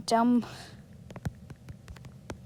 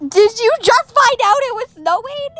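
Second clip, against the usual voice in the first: high, loud squealing question; shrieking; 11 semitones higher, 9 dB louder than usual. Real, not acted. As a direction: squeal it out in disbelief.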